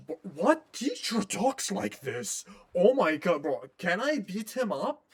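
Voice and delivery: high, loud outraged cry at the game; real outrage, half laughing at how absurd it is; outraged disbelief. Real, not acted. Player fuming at the rude teacher, outraged high cry.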